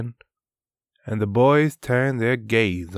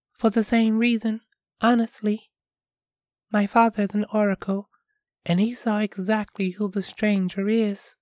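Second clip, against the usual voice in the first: put-on higher, quick young girl; a girl explaining her parents' fears, higher voice; 12 semitones higher, faster than usual. Piola voiced in story.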